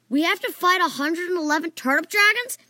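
loud, high incredulous question; crying out. A narrator's reading. Dreamer learning of a hundred and eleven dragons, crying out. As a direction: cry out in disbelief, pitch climbing. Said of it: "I cried".

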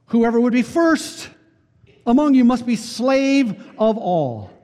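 fervent preaching. Acted. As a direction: drive the point home to the congregation.

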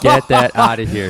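high, loud dismissal; mock outrage. Real, not acted. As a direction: reject it with a laugh. Rejecting nougat jokingly.

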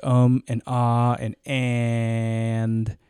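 "um", "ah", "and" stretched out slowly; drawn-out filler words. Shown as a demonstration. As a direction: hold the last "and" like stalling. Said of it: "using filler words like".

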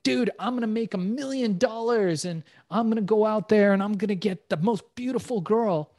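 lightly mocked bragging dude; cocky bro talk. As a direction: send up a guy bragging about money and girls. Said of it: "The guys that talk like that".

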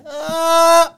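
high, loud imitated cry; loud mock "Ah!". Telling how his girlfriend reacts, loud mock outburst.

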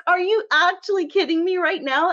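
high, amused disbelief; half laughing at the absurdity; incredulous. Retold or imitated. Retelling her reaction to the mansplainer, amused disbelief. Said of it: "I'm like".

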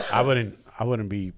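low, soft deadpan; dry doctor put-down. Retold or imitated. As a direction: imitate a doctor quietly deflating a boast.

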